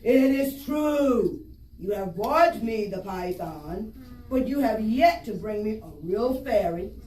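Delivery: booming sky god. Acted. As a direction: boom it down like an angry god. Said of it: "he scowled".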